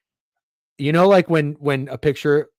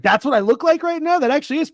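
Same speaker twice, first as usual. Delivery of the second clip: higher, louder retold amazement at the photo; amused surprise; 12 semitones higher, 4 dB louder than usual. Man retelling seeing wife's photo of him, surprised and amused. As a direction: play back a delighted double-take.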